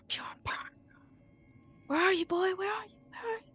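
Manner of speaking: high, eerie whispering call from below; eerie ghostly whisper. Acted. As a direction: call for the lost boy like a ghost. GM voices whisper from the well.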